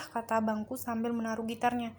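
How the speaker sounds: usual speaking voice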